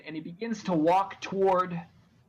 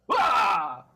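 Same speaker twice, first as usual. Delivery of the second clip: loud sharp cry; crow shout; 3 semitones higher, 7 dB louder than usual. Acted. Crow shouting at purple pigeons, loud cry. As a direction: bark the cry out. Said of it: "he does the same thing".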